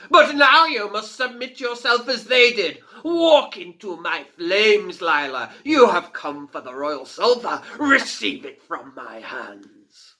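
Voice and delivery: laughing fire fiend. A narrator's reading. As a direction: relish her doom.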